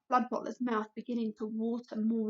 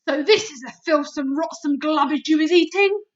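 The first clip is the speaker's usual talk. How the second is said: loud booming giant's disgust; 3 semitones higher, 10 dB louder than usual. A narrator's reading. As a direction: boom it out like a brute giant. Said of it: "boomed the blood bottler".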